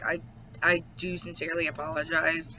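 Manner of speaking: usual speaking voice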